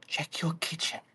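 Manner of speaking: breathy whisper of the suggestion. Retold or imitated. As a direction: whisper it like a secret tip. Chat suggestion echoed in breath.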